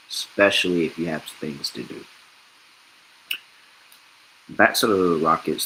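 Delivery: usual speaking voice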